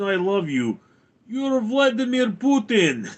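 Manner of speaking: high, hammed-up Russian accent. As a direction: play an amused Russian, pitched well up. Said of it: "Simply talk like a Russian".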